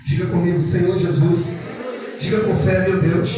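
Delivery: loud call-and-response preaching; loud fervent prayer leader over music. Acted. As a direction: lead the congregation's declarations at full force. Leads congregation in loud prayer.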